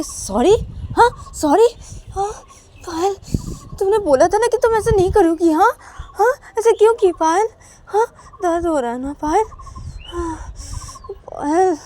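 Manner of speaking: high, loud wounded whimpering reproach; crying in pain. Acted. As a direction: cry out and accuse her. Ananya complains of pain.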